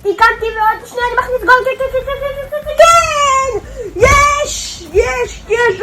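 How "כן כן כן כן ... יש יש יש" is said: goal celebration. Real, not acted. Scoring a goal.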